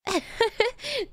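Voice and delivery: high, gleeful villain laugh; cackling self-satisfied laugh; evil laugh. Acted. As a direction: laugh like a proud supervillain. Villain introduces himself.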